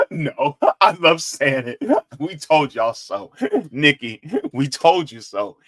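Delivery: gloating through chuckles; gleeful gloating. Real, not acted. Saying I told you so to Nikki, laughing gleefully.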